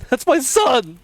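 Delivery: high, very loud cry for his son; desperate father's shout. A narrator's reading.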